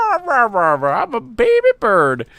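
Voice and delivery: put-on high, slow squeaky baby bird voice. Begging chick declaring itself a baby bird, high and slow. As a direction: pout it out like a tiny chick. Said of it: "And I'm just like".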